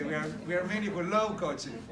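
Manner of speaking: ordinary talk, nothing marked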